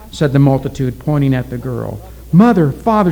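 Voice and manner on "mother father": high, loud call to parents; crying out. A narrator's reading. Doomed girl to her parents, crying out. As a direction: call out to them in distress. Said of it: "she cried".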